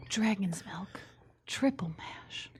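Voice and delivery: breathy, hushed beer description; sultry whisper. Pretend. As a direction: whisper it like a seductive ad. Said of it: "That's my sultry voice".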